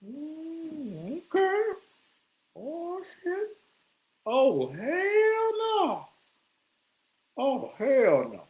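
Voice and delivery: comic creeping horror; mock horrified. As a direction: stretch out a high appalled hell no. Reacting to sex in street.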